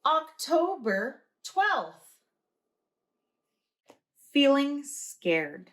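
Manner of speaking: drawn-out, high and slow; slow deliberate reading. Acted. Reading the date slowly to listeners, high and drawn out. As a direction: read each word slowly and high.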